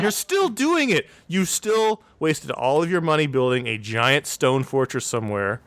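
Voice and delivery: exasperated outburst. Retold or imitated. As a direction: stretch it out in disbelief at them. Exasperated reaction to the villains.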